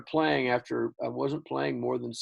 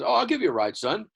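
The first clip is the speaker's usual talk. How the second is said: dad's voice; 10 semitones higher, 5 dB louder than usual. Retold or imitated. Dad voiced in retold story.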